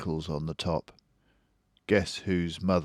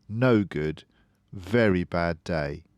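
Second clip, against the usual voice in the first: ordinary delivery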